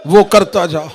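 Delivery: loud, high grieving recitation; full-force majlis lament. Acted. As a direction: preach the farewell at full force, wailing. (पूरे ज़ोर से रोता हुआ मजलिसी बयान)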